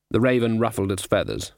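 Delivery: ordinary delivery, nothing marked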